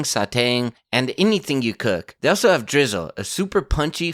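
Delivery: scripted podcast sponsor read. Acted. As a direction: sell it smoothly with lively, rehearsed emphasis. Host reads sponsor ad.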